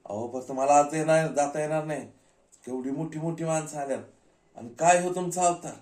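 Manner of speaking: high, scolding gatekeeper; arguing security guard. Acted. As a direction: belittle the visitor and refuse entry. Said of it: "थोडीशी बाचाबाची झाली".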